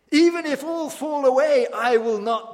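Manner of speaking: loud, high, boastful vow; Peter's bold declaration. Acted. Peter boasting to Jesus, a loud proud vow. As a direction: proclaim it like a proud disciple. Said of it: "declares Peter".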